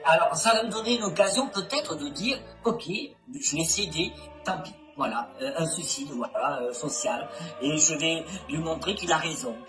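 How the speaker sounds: anonymized low distorted voice; disguised voice. Real, not acted. Anonymized interviewee testimony.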